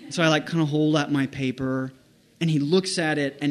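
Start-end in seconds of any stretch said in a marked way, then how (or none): none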